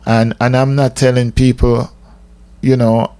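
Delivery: ordinary talk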